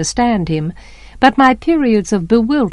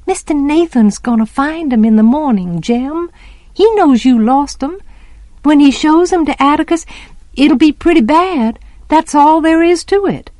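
put-on higher-pitched, insistent child's pleading; urgent reasoning; 6 semitones higher than usual. A narrator's reading. Child trying to reason with Jem, urgently insistent. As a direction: argue urgently, rising higher as you go. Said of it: "I tried to reason with him".

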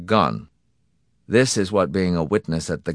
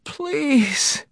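very high, drawn-out tearful plea; close to tears. A narrator's reading. Husband begging into a dead line, close to tears. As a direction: beg helplessly, pitched right up. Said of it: "close to tears".

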